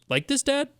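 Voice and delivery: high, eager little-boy voice, lightly imitated; son's voice. Young son asking his dad for approval, high kid voice. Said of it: "when his son is like".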